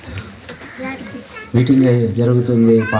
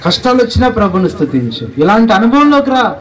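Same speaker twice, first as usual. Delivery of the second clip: high-pitched, rousing exhortation; impassioned preaching; 9 semitones higher than usual. Real, not acted. Preacher urging the congregation to praise through troubles, impassioned. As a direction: urge it on them in a raised preacher's pitch.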